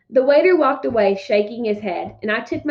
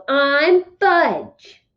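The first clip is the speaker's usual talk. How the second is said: yelled; 5 semitones higher, 4 dB louder than usual. A narrator's reading. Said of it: "he really let go and yelled".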